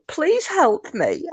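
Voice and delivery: high, slow, drawn-out whine; a slow pleading whine; whiny voice. Retold or imitated. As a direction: beg like a sulky child. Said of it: "I'm trying to put a whiny voice on".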